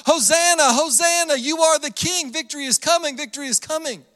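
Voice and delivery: high, acted jubilant acclaim fading off; cheering crowd. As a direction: play a crowd hailing its king. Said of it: "these people that are saying".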